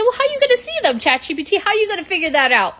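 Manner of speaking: very high, loud challenge; high incredulous question. Acted. [name] challenging ChatGPT in a high voice. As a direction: squeak out the doubt.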